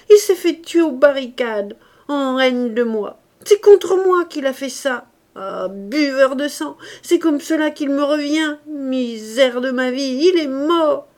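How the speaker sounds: loud, high, furious lament; bitter old man wailing his loss; grief-stricken rant. A narrator's reading. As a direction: rail against the dead boy. Grandfather raging at grandson's death.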